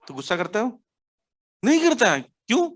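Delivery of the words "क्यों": ordinary talk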